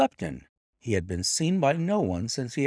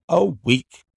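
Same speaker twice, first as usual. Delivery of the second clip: firm refusal; 8 semitones higher, 8 dB louder than usual. A narrator's reading. Master refuses servant firmly.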